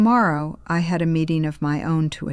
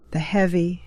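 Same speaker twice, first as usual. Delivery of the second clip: usual speaking voice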